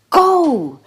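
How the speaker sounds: loud, high shout from the stands; excited shout. Acted. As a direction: yell it out over the crowd noise. Crowd shouting at the final.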